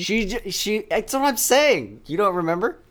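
high, slow disbelief; incredulous. Real, not acted. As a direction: sound amazed they forgot. Disbelief partner forgot.

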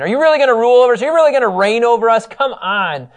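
loud, high mocking disbelief; scoffing brothers. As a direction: sneer at the dreamer.

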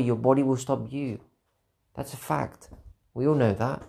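usual speaking voice

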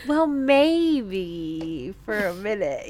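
coy mock Zelda. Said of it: "I love how she's like".

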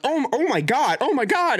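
high-pitched imitation of panicked people; hammed-up shocked alarm; mock freak-out. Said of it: "they're, like, freaking out".

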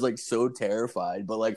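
ordinary delivery

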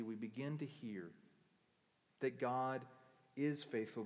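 ordinary delivery

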